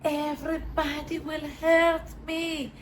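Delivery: put-on high, slow, small scared child's voice; six-year-old child. Recalling her inner child, a small slow child voice. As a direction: speak it as the frightened six-year-old inside. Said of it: "like six years old".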